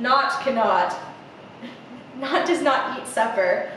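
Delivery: plain reading voice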